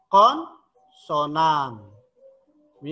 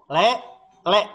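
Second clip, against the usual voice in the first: Le, le with each le pressed a little; teacher presses on le, le; slightly pressed; 3 dB louder than usual. Shown as a demonstration. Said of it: "Agak ditekan".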